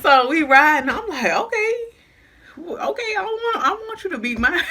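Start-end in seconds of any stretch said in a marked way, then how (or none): 1.3-4.7 laughing dare. Retold or imitated. Daring friend, laughing.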